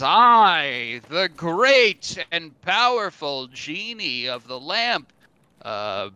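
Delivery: slow, booming genie proclamation; grand genie. Acted. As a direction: proclaim it like a mighty genie of the lamp. Genie grants wish grandly.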